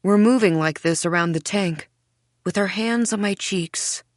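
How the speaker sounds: ordinary delivery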